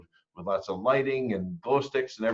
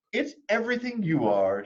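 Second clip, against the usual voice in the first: high, lightly voiced comforting mother; reassuring mother; 10 semitones higher than usual. Mother reassuring her daughter, high and gentle. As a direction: soothe her like a loving mom. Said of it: "said her mother".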